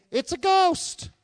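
high, slow, loud scared shout; frightened cry. Acted. Disciples in the boat shrieking at the ghost, high and loud.

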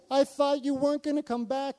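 high, loud mock panic; imitating freaked-out girl. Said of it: "She was freaking out".

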